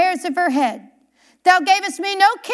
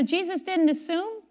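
normal talking voice

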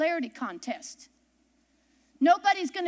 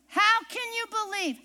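higher repeated question; 6 semitones higher than usual. Shown as a demonstration.

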